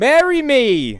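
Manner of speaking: high, slow hammed-up plea; mock proposal. Mocking the hero proposing to the nurse, high and slow. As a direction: shout it like a lovesick fool.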